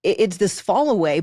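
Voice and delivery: normal talking voice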